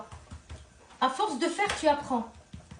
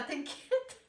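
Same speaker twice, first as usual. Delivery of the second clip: en riant; 3 semitones higher, 6 dB quieter than usual. Real, not acted. Taquine en riant.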